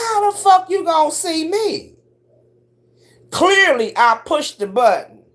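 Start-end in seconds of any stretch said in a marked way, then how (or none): 0.1-2.0 high, baffled question; stunned disbelief. Retold or imitated. Caught eating ice cream on camera, high and baffled. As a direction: ask it caught off guard. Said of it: "I said".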